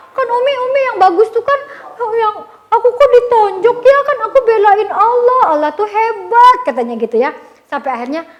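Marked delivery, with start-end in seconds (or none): 0.1-6.6 high, loud mock-tearful protest; imitating complaining child. Boy complains to his mother about the punch, high whine. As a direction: whine to mum like a wronged little boy. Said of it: "Terus dia pulang gitu ya".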